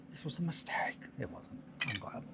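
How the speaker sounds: rueful whispered aside; glue-covered hands, admitting defeat in a whisper. Real, not acted. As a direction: whisper it to yourself, regretting. Muttered regret over super glue.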